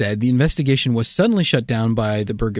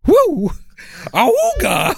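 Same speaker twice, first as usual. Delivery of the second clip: excited whoop; 13 semitones higher, slower than usual. Real, not acted. Excited whooping over a joke.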